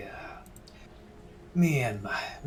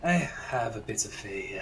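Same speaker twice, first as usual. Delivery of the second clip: whispered; 2 semitones lower than usual. Acted. First take of line, whispered.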